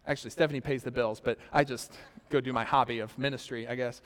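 quick, low, soft throwaway joke; tossing it off under his breath; wry aside. Real, not acted. Pastor joking to the congregation about his wife paying the bills, in a wry aside.

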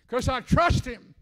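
high, loud, slow cry of faith; father crying out his trust; sudden cry. Real, not acted. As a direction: burst out, stretching the words. Emotional outburst after quiet.

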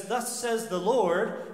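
false prophet addressing Zedekiah, grandly; mock prophetic intoning.

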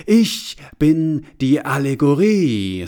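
loud, slow and self-important; pompous declaration. Acted. Allegory introduces itself to the bowing crowd.

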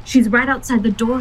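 urgent, frightened warning; desperate. A narrator's reading. Lupita warns the others the spirit is at the door, desperate. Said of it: "Lupita said desperately".

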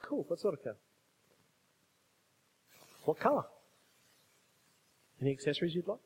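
soft, low, casual voice; easygoing God. Acted. God taking a car order from a believer, soft and easygoing. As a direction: play God as an agreeable helper, quietly asking. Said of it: "God's up there going".

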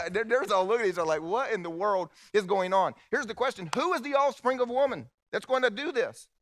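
booming sermon build; preaching at full force. Acted. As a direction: proclaim it from the pulpit.

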